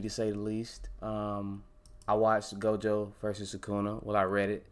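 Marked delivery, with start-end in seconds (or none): none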